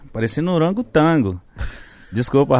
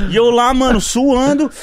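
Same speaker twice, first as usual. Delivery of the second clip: ordinary delivery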